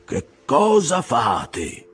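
a captain crying out to his crew; shouted question. A narrator's reading. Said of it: "Acabe gridò". (gridato lento, da capitano alla ciurma)

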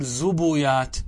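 زبيات as "zubuyaat", short u after ز and ب; slow "zu-bu-yaat" for زبيات; damma on both. Shown as a demonstration. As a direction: say زبيات with the ب copying the u of ز. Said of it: "بضم الفاء والعين".

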